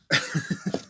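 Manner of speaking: loud breathy laugh; short, high burst of laughter; chuckle. Real, not acted. Man admitting to being a closet fan, laughing loudly.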